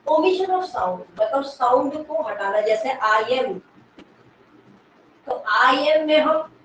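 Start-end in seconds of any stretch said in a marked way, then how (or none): none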